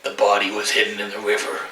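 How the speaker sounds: hammed-up whisper of a dark secret; mock sinister villain. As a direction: murmur it like a shady schemer hiding a crime. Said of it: "so easy for me to say".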